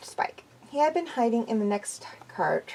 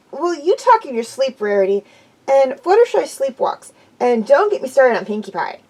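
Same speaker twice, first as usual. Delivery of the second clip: brash tomboy voice, a bit louder; Dash's voice; 3 semitones higher, 6 dB louder than usual. A narrator's reading. Dash listing friends' sleep habits, brash and louder. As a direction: Rainbow Dash teasing her friends.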